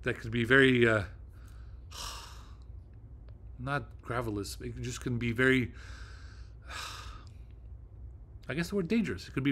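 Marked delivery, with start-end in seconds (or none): 1.1-2.8 long, soft exhaled sigh; weary voiceless sigh. Real, not acted. Searching for the right word. 6.7-8.4 long, soft exhaled sigh; quiet voiceless sigh. Real, not acted. Still searching for the word.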